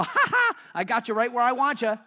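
high, loud ha-ha, then gloating; mock triumphant laugh. Preacher taunts the devil from the corner, loud gloating laugh. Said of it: "I just laugh and go".

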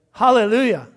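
loud joyful hallelujah; preacher's hallelujah. Real, not acted. Preacher's exclamation to congregation.

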